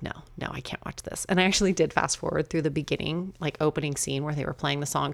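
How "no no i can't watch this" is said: quick, breathy near-whisper of a mock refusal; hushed refusal. Dreading the movie's theme song, a mock hushed refusal. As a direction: rush it out under your breath, half joking. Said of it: "I just was like".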